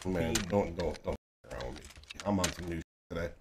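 quiet, fast boasting; low fast brag. Real, not acted. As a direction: mutter the boast quickly. Bragging quietly between fights.

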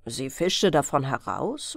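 usual speaking voice

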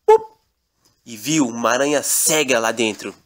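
plain reading voice

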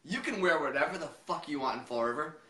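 usual speaking voice

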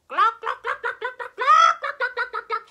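high staccato clucks with loud bursts; hen clucking. Pretend. Storyteller leads children being hens, clucking. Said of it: "what noise do we make?".

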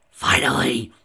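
loud outburst; loud exclamation. A narrator's reading. Character exclaims during a massage, loud outburst. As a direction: burst out with it at full voice. New scene, exclaimed line in dialogue.

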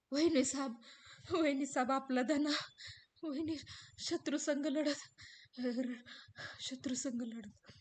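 halting, teary words; a touch of crying through it; tearful servant, mild. Acted. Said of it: "रडत रडत रामू बोलू लागतो". (रडत रडत बोलणारा रामू, हलकेच)

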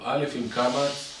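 drawn-out alphabet drill; teacher drilling child. Acted. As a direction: chant the letter lesson slowly to a small child. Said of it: "ילד, אתה אומר לו".

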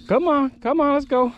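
eager urging; hurrying someone. Real, not acted. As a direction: come on, hurry it up. Urging on the fish.

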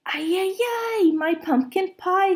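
put-on a childlike squeal of joy over the pie; excited. B J admires the pie.

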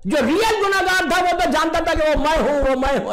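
high, loud emphatic preaching; loud high sermon climax. Acted. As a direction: lift it to a climax.

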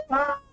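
sudden high frightened scream. Real, not acted. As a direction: shriek in fright. Scared scream at noise.

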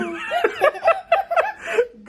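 shrieking high laugh; high burst of laughter. Real, not acted. Laughs at daughter's rude word.

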